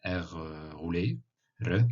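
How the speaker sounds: r rolled on the tongue tip. Shown as a demonstration. Showing students the rolled r. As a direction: roll the r clearly. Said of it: "ça se prononce r".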